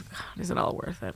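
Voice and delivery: soft, hopeless whisper; despairing whisper. Retold or imitated. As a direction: sigh out the doubt. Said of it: "I just feel like".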